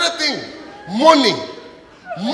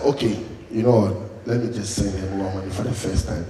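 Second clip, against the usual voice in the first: much lower, soft defeated voice; resigned giving in; 12 semitones lower, 7 dB quieter than usual. Acted. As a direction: give in with a sigh. Giving in and sending money.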